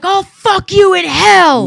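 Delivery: loud, high, drawn-out movie outburst; pissed off. Retold or imitated. Quoting a pissed-off movie character, angry drawn-out shout. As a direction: act out a furious slow shout. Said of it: "this guy was pissed off".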